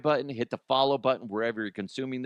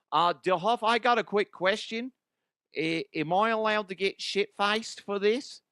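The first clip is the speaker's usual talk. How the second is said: high, raised Ozzy character voice; a high, slurring party-guy voice; 6 semitones higher than usual. Acted. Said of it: "Ozzy DeHuff".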